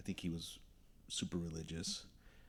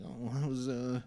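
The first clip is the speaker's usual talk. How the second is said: ordinary talk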